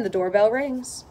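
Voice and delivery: ordinary delivery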